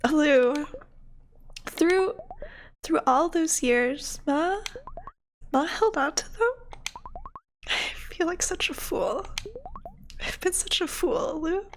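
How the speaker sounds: high, halting voice full of feeling; overwhelmed, tearful mother. Acted. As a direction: falter upward in disbelief, on the edge of tears.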